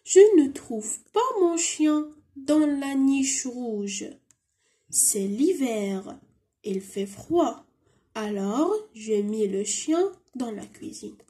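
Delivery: very slow dialogue reading; careful slow French read-aloud; slow model reading. Shown as a demonstration. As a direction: read it through slowly for the class. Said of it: "نروحوا للسؤال اللي بعدها".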